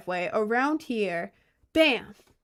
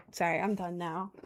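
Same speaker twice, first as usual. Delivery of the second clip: ordinary delivery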